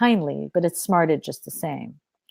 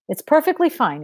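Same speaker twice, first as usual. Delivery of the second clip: cool, superior reply, pitched up; haughty, intimidating; 7 semitones higher than usual. Acted. Woman answering the soldier, haughty and intimidating. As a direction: look down on him as you say it. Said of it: "an intimidating and haughty voice".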